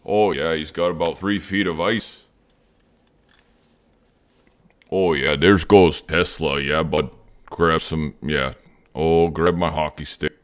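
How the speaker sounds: loud mock Minnesota accent, shouting about hockey; Minnesota hockey bit.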